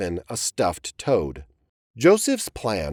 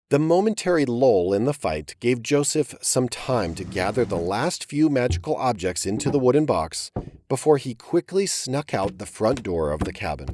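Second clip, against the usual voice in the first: ordinary delivery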